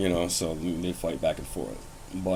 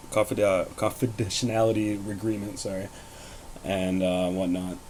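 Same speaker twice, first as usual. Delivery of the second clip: ordinary talk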